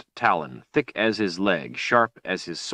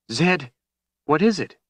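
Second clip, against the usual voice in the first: high, urgent worried question; alarmed; 9 semitones higher, 3 dB louder than usual. A narrator's reading. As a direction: call to him in rising alarm. Woman alarmed at stricken wizard.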